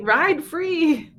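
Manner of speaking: high, bright cheer of freedom; playful cheer. Acted. Cheering the writer on, a high playful call. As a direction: sing it out like setting her loose.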